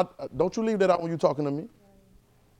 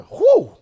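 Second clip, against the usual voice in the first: whew; 10 dB louder than usual. Real, not acted. Preacher exclaims before building up.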